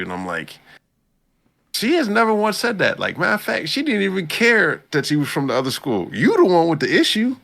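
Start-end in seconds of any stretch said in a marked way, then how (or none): 1.7-7.4 higher and louder, indignant rant about her; worked-up disbelief. Real, not acted. Fan ranting to cohost about the girl, indignant and loud. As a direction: get fired up in disbelief.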